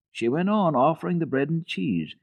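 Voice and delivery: plain reading voice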